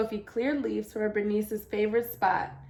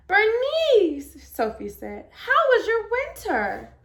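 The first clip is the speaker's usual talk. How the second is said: put-on much higher, louder delighted child; joyful greeting; 10 semitones higher, 6 dB louder, slower than usual. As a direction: greet a long-lost friend with joy. Joyful reunion with sprout.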